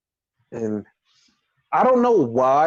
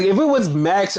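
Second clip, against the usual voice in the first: normal talking voice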